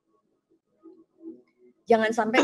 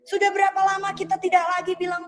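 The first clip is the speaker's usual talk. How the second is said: preaching; 9 semitones higher than usual. Acted. Pastor challenges online church about cold prayer, preaching. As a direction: press each "sudah berapa lama" harder. Climax of appeal.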